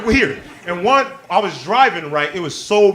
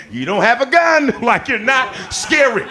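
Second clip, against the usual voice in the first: mock defiant shout; 6 semitones higher, 4 dB louder than usual. Defying the cop.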